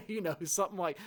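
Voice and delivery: talking through laughter; real giggle in the words; laughing. Real, not acted. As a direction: still laughing while speaking. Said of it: "I don't mean to laugh".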